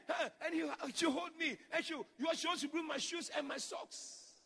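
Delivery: ordinary talk, nothing marked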